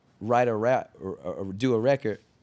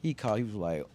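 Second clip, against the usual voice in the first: ordinary talk, nothing marked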